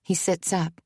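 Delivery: usual speaking voice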